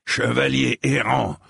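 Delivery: a mild narrated growl of contempt. A narrator's reading. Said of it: "gronda-t-il".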